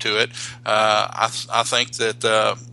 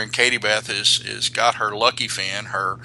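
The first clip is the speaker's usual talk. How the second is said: ordinary delivery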